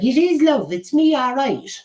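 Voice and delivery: high-pitched put-on old granny voice; Irish granny. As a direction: pipe up as a playful Irish granny character. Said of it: "Mad Irish Granny".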